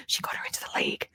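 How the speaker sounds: quick, hushed gossipy whisper; fast stage whisper, no voice. Retold or imitated. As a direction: whisper it like an eavesdropper passing on news. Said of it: "she's, she's whispering".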